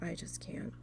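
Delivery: breathless whisper of giving up; whispering she is finished with him; whispered defeat. Retold or imitated. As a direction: drop to a whisper, totally done. Fed up, walking away.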